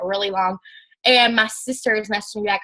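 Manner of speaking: ordinary delivery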